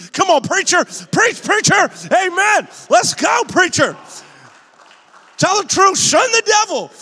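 high, loud shouts of amen; shouted amens from congregation. Pretend. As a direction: shout back at the preacher like the amen corner. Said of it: "I'm shouting amen to Pastor".

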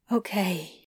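soft, low, small reply; whispered. Acted. Adam accepting Crowley's words, whispered. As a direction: answer almost under the breath. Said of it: "Adam whispered".

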